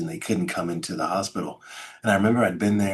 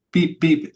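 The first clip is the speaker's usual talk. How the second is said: high, louder mimicked monitor beeps; heart monitor beeps; 8 semitones higher, 4 dB louder than usual. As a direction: imitate the beeping of a heart monitor.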